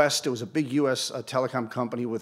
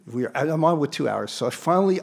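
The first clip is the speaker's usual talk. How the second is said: ordinary delivery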